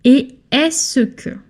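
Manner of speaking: "Et est-ce que" slow and high, eh ess-kuh, without the k. Shown as a demonstration. Teacher setting "est-ce que" apart from "qu'est-ce que". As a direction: say "Et est-ce que" slowly, no k at the start. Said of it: "Est-ce que is not qu'est-ce que".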